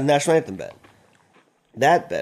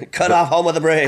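laughing; 5 semitones higher, 7 dB louder than usual. Pretend. Joking about cutting anthem.

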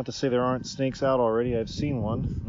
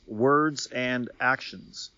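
slow, deliberate weight on words and actions; stressed emphasis; much slower than usual. Shown as a demonstration. Marking the emphasized part of a quote, slow stress. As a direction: lean hard on each word, slowly.

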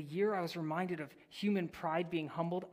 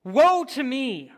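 loud, slow cry of dread; slow loud lament; 8 semitones higher, 14 dB louder, slower than usual. Acted. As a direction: cry out in dismay. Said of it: "I cried".

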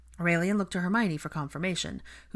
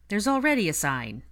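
pointed, louder comeback; countering; 5 semitones higher, 6 dB louder than usual. A narrator's reading. Ginny counters a visitor's suggestion about a sign, pointedly. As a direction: push back firmly, voice raised in pitch. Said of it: "Ginny countered with her hand on her hip".

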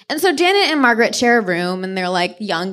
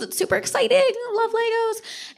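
put-on high childish gushing; excited kid. Mimicking the children, a high kid voice. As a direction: gush like a little kid.

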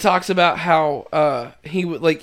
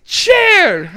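high loud bark of a single word; shouted word; 13 semitones higher, 6 dB louder than usual. Retold or imitated. Father Jack naming objects, barking loudly. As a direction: yell the word like a confused old man.

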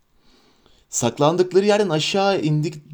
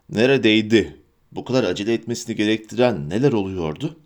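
ordinary delivery

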